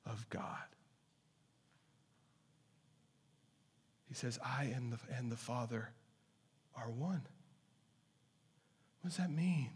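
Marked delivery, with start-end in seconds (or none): 0.1-0.7 breathy whispered ending. Acted. Preacher closing his declaration, whispered reverently. 4.1-7.3 soft, slow, much lower near-whisper; reverent hush. Acted. Preacher declaring Jesus is God, hushed and awed.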